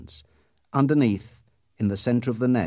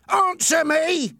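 high, loud, slow shout of a bully; 6 dB louder, slower than usual. Acted. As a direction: bark the order at him. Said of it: "Ernie shouted".

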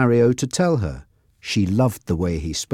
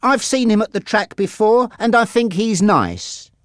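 put-on higher voice for the young girl; lightly voiced girl; 11 semitones higher than usual. A narrator's reading. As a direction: read her line a bit higher, eager. Susan's dialogue in story.

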